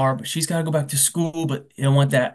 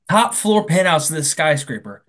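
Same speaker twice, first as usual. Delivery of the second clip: ordinary delivery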